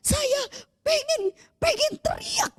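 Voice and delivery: high, tearful, quivering words; tearful woman recalling joy; crying testimony. Retold or imitated. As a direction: sob out the confession.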